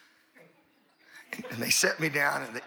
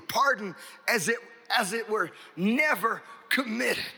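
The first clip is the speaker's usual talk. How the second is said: preaching at full force; 10 semitones higher, slower than usual. Acted. Preacher proclaiming to the congregation, ringing shout. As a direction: ring it out like a revival preacher.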